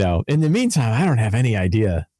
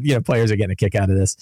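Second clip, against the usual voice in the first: ordinary talk, nothing marked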